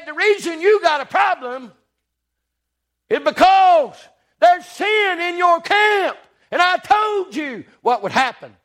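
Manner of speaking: God shouting the reason at Joshua; stern divine scolding. Acted. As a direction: hammer home the warning. God tells Joshua of sin.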